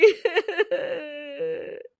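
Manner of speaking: long high wailing sob; fake crying sob. Ritu keeps up fake tears in front of Riya. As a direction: trail off into forced weeping. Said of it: "जोर जोर से रोने लगती है".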